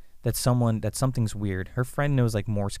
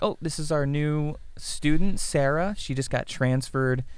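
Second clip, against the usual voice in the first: lightly voiced teacher, higher pitch; teacher introducing student; 6 semitones higher than usual. Teacher presents new student Sarah to class, lightly acted higher voice. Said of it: "And she's like".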